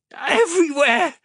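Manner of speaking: very high, loud sobbing cry. Acted. Xander answers the orderly, sobbing in pain. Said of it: "He sobbed".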